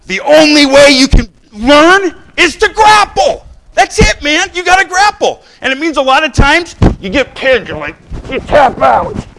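loud, high battle cry sermon; rally them to fight; preacher shouting a call to war; preaching shout. Acted. Preaching at full force.

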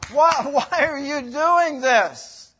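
slow mock whine broken by a laugh; plead, half laughing; laughing plea.

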